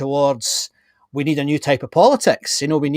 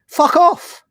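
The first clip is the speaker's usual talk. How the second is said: fuck off; 13 semitones higher, 5 dB louder than usual. Retold or imitated. Politician telling critics where to go, loud and high. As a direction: blurt it out much higher and louder. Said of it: "I want politicians ... to say".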